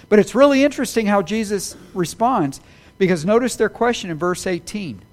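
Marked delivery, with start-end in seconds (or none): none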